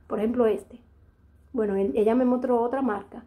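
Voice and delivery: ordinary talk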